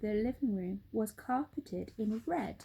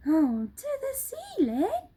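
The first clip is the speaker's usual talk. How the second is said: high, loud astonished small monkey; surprised cry; 13 semitones higher, 6 dB louder than usual. Acted. Small monkey doubting his dad, high surprised cry. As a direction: gasp it in disbelief. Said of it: "cried one of the small monkeys".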